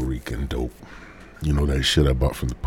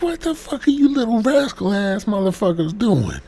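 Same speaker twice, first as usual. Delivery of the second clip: much higher voice, jeering; mocking protester. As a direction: sneer at the kids in a squeaky put-on voice. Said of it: "These protesters like".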